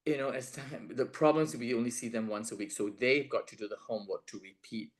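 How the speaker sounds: ordinary talk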